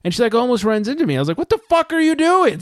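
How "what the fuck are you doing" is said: very high, raised angry outburst; angry yell. Retold or imitated. Yelling at the woman backing into him, angry. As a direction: snap at her as she backs into you. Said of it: "So then I get angry again".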